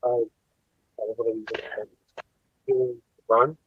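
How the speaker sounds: faint. Real, not acted. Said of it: "You sound really faint to us".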